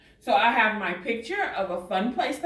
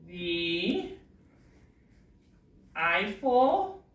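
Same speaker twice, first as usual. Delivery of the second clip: slow dictation; 4 semitones lower, much slower than usual. Shown as a demonstration. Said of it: "Then we wanna have a space".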